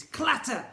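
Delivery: sharp high clank; high loud clank. Acted. Narrator sounding the robot hitting the floor, loud clank. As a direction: bang out the clank.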